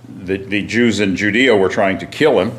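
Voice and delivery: ordinary talk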